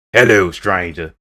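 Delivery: slow, loud put-on shopkeeper greeting; merchant impression. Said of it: "I think I'm doing a pretty good one".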